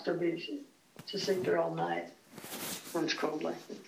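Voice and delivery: usual speaking voice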